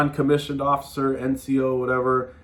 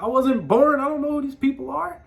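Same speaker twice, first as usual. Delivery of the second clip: high protest; 10 semitones higher than usual. Retold or imitated. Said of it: "I was like".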